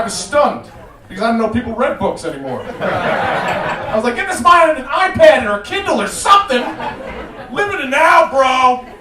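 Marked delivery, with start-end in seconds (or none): 4.2-8.9 high, loud incredulous shout; indignant comic outburst, pitched up; mock outrage. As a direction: yell it like an exasperated bystander. Acted outrage at book-reading driver.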